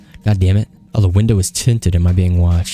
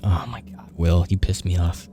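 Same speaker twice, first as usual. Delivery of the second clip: frustrated whisper; 8 dB quieter than usual. Real, not acted. Exasperated at game character.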